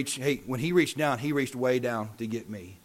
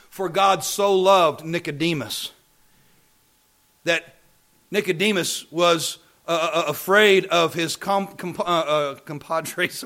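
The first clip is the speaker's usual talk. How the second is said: raised, louder pulpit voice; forceful preaching; 4 semitones higher, 8 dB louder, slower than usual. Real, not acted. Preacher telling congregation about Nicodemus, forceful preaching. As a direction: push it out, stumbling for the word.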